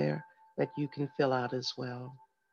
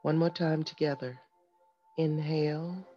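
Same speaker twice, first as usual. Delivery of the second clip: soft, calm breathing guide; guided meditation; 5 dB quieter than usual. Acted. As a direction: lead them gently through each breath. Leads a breathing exercise.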